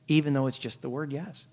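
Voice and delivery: normal talking voice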